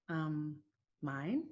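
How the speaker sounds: low, soft, hesitant reply, retold; hesitant answer. Driver telling the officer whose car it is, quiet and hesitant. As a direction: let it out quietly after a pause.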